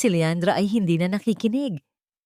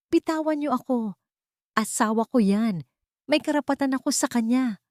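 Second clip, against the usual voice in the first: shouting; 7 semitones higher than usual. Acted. Marco held back by guards, shouting high-pitched. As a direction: yell to be let go, pitched up high. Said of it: "sigaw ni Marco".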